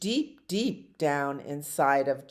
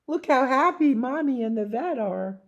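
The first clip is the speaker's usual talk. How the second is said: higher, quick little puppy voice; 6 semitones higher, 3 dB louder, faster than usual. Acted. Puppy George delighted at his happy mommy. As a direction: chatter brightly as the little dog.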